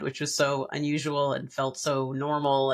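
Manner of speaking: ordinary talk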